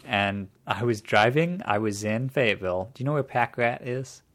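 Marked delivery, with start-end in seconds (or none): none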